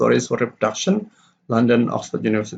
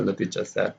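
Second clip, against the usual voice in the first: "the teacher said" low and much softer; unstressed tag; 5 semitones lower, 9 dB quieter than usual. Shown as a demonstration. Teacher reading the reporting clause, unstressed. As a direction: throw away "the teacher said" as unimportant. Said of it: "it's not stress because that's not important".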